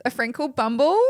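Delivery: amused retelling of the couple; laughing quote. Retold or imitated. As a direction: deliver the joke half laughing. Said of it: "they're like".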